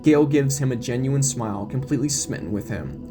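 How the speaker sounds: ordinary delivery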